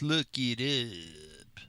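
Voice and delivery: slow, drawn-out shop teacher impression; lingering small-town teacher catchphrase; Dave Sander. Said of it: "that's how he would say it".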